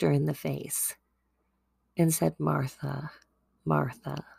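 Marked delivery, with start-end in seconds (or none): 2.4-4.2 much softer, lower, loving repetition of the name; tender reassurance. Acted. Jesus holding Martha's hands, soft reassuring repeat. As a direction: say it softly, hands held, face to face. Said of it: "it's like saying, "Oh, honey".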